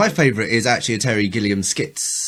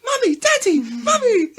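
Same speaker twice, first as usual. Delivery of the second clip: tiny high mock child cries; child leaf voices. Little leaves cry for their parents, high mock distress. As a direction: call out in squeaky falsetto, pleading. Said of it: "just sort of a couple of voices".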